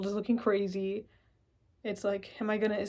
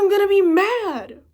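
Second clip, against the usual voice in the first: getting mad; 7 semitones higher, 11 dB louder than usual. Acted. Stranded driver admitting out loud how mad he is. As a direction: let the anger build up.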